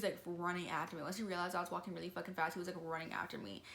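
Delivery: ordinary delivery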